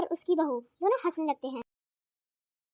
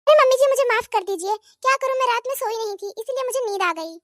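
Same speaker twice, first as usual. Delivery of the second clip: higher and fast, begging; pleading daughter-in-law; 6 semitones higher, faster than usual. Acted. Daughter-in-law begs mother-in-law not to leave, fast pleading. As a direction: rush out the apology.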